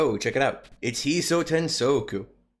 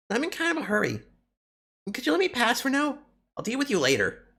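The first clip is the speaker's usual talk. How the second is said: put-on much higher, quick impatient girl voice; squeaky hurried kappa girl; 11 semitones higher, faster than usual. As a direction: brush them off in a high hurried voice. Voicing a second game character.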